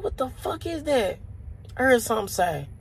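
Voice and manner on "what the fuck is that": high, puzzled falling question; baffled. Retold or imitated. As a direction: wonder what made that noise, pitched up. Puzzled by the noise.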